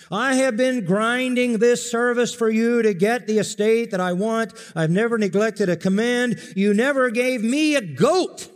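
high, loud grievance; older son complaining to his father; resentful older son. Acted. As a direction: hurl the grievance at him. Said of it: "older son complains".

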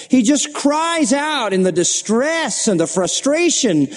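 high, drawn-out, rising intensity; impassioned preaching. Real, not acted. Preacher telling congregation of Paul's distress, impassioned. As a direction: preach it with mounting anguish, pitched high.

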